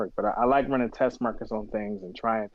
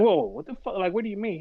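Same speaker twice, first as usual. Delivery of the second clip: shocked; 11 semitones higher than usual. Retold or imitated. Shocked at billionaire reveal.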